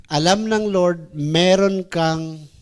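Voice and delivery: normal talking voice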